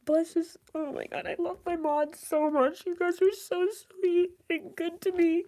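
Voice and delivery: high, tearful gratitude; crying. Real, not acted. Streamer praising their mods, really crying. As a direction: choke up thanking them, voice climbing high. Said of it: "exactly why I'm crying".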